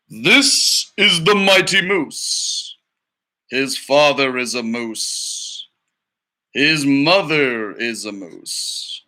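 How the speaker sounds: slow, grand documentary voice; nature documentary narrator. Acted. Teacher reading to students as the documentary narrator, slow and grand. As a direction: narrate the mighty moose like a wildlife film.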